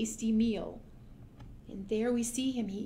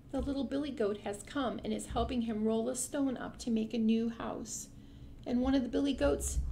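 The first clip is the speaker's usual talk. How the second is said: ordinary delivery, nothing marked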